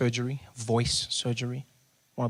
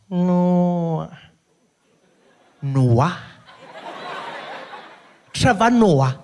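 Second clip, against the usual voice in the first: loud, drawn-out name, climbing higher; receptionist recognizing; 5 semitones higher, 8 dB louder, much slower than usual. Retold or imitated. Receptionist realizes who the patient is, loud suspicious role voice. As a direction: sound it out loudly with dawning recognition.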